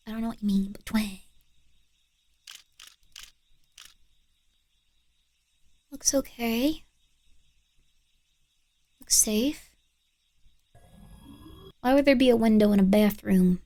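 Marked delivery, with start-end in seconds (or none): 0.1-9.7 hushed whisper, barely voiced; tense watchful whisper. Real, not acted. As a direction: whisper so nothing hears you. Whispering while watching cameras.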